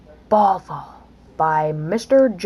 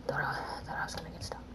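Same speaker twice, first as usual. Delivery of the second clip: rushed relieved whisper; fast whisper; 8 semitones lower, 17 dB quieter, much faster than usual. Real, not acted. Gamer whispering relief after nearly getting stuck. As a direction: whisper it quickly, half to yourself.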